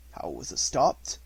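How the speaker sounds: plain reading voice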